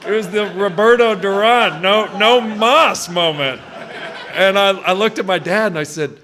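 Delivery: telling it while cracking up; real laughter breaking through the story; laughing hard. Real, not acted. Cracking up retelling dad story.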